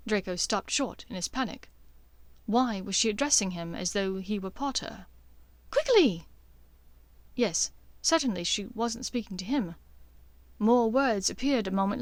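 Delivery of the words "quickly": higher, urgent and hurried; panicked Hermione. Acted. Hermione urging Harry to fetch the dittany, higher and frantic.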